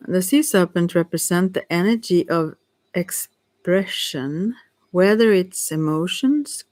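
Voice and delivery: slow, halting reading with long gaps; reading slowly. Real, not acted. Reader straining to see the card, slow and halting. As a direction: feel your way through dim text, word by word. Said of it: "I'm reading slow because".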